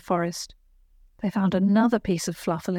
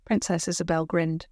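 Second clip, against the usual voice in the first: ordinary delivery, nothing marked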